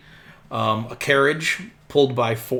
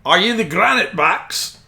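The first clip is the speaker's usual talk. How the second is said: higher-pitched hearty driver calling out; carriage driver; 6 semitones higher than usual. Acted. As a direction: hail them as the coach driver. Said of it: "And he says".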